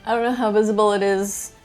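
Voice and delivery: normal talking voice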